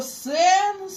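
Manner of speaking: "сен" held slow, the Polish way; drawn-out Polish "сен"; Polish pronunciation. Shown as a demonstration. Teacher gives a simple Polish example, slow Polish pronunciation. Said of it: "Наприклад".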